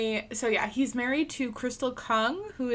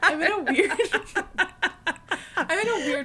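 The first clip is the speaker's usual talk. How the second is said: laughing; 6 semitones higher, 4 dB louder, much faster than usual. Real, not acted. Laughing at own silliness.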